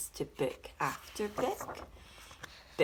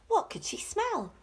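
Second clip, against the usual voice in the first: usual speaking voice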